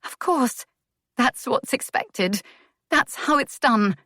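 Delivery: put-on a young lady forcing out what is expected; resigned desperation. A narrator's reading. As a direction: say it like a duty she hates. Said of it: "a little desperately".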